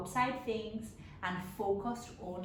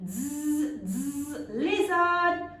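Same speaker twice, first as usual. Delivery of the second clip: 1 muttered buzz; 5 semitones higher, 5 dB louder, much slower than usual. Acted. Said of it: "Mosquito muttered".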